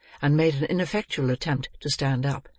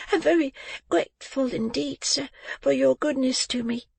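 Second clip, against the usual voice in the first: put-on high, thin child's voice; earnest thanks in a much higher voice; grateful little boy; 11 semitones higher than usual. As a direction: speak as a polite, frail boy. Sick boy thanks his benefactor.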